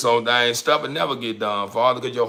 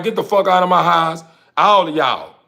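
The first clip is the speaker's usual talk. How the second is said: loud, higher yell throwing him out; furious shout; 6 semitones higher, 9 dB louder than usual. Retold or imitated. Woman kicking freeloaders out of her house, shouting furiously. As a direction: bark it at them, fed up.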